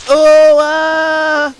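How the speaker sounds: high, loud cry of dismay; anguished groan. A narrator's reading. Percy crying out after smashing the luggage. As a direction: wail as the crash hits. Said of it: "groaned Percy and stopped".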